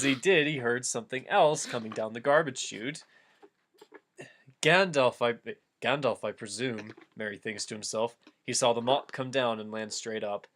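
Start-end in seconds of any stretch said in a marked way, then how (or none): none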